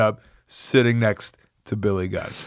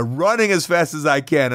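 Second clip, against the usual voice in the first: ordinary talk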